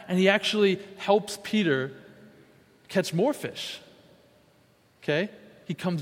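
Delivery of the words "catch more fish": ordinary talk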